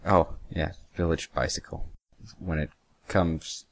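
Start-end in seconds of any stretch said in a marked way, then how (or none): none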